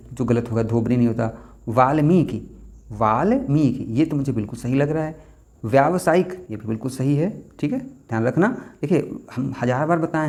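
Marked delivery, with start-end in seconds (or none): none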